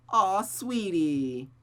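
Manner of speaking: drawn-out, cooing aw sweetie; slow cooing. Acted. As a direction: coo it slowly and fondly. Cooing at partner.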